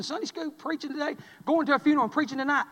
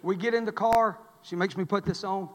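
ordinary talk, nothing marked

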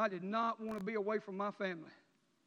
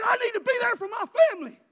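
high, loud, breaking cry; sobbing plea; 13 semitones higher, 15 dB louder than usual. Retold or imitated. Man sobbing to rehab counselor he must go home, a loud breaking cry. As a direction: beg through tears as if sobbing. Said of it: "I was crying, I was snotting".

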